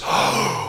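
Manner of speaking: sharp unvoiced gasp; a boy gasping as he comes up from the water; gasp for air. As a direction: gulp air like you just surfaced. Surfacing after nearly drowning.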